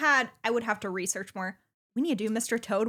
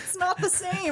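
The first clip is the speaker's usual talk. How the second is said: high-pitched laughing objection; laughing protest; 10 semitones higher than usual. Real, not acted. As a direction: squeal the objection through laughter. Laughing argument over nicknames.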